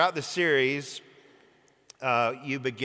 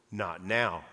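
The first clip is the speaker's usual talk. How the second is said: Aslan answering; 7 semitones lower, 5 dB quieter than usual. A narrator's reading. Aslan voiced in read story.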